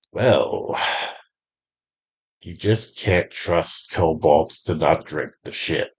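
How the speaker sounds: a lightly voiced deep kobold, low and distant; a hint of a gruff kobold grumbling from far off. Kobold guard grumbles to another about drinking, lightly voiced, low and distant. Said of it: "you hear a deep kobold's voice going distantly".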